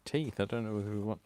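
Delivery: ordinary delivery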